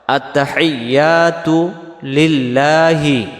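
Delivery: আত্তাহিয়াতু লিল্লাহি with stretched long vowels; measured, drawn-out আত্তাহিয়াতু লিল্লাহি; slow model recitation. Shown as a demonstration. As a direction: chant আত্তাহিয়াতু লিল্লাহি at half speed. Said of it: "আবার".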